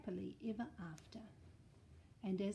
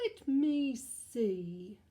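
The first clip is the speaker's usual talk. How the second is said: slow louder bear musing; pondering bear; 9 dB louder, much slower than usual. Acted. The bear deciding on dessert, slow and drawn out. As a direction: drag it out thoughtfully. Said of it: "said the bear".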